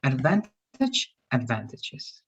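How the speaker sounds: advantage then advantages, slow; slow model pair. Shown as a demonstration. Teacher modelling singular then plural, slowly. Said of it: "First in singular and then in plural".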